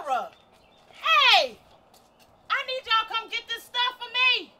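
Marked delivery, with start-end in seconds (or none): 0.9-4.1 loud call after them; shout. Acted. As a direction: yell to stop them leaving. Shouting after departing movers.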